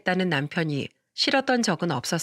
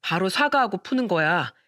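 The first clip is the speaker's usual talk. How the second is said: usual speaking voice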